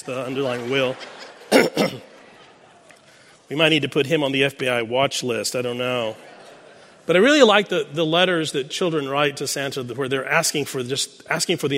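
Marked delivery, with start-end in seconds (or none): none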